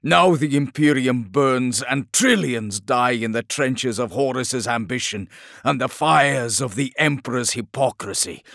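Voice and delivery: loud, proud and bitter tirade; scornful warlord voice. A narrator's reading. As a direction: declaim with contempt for all.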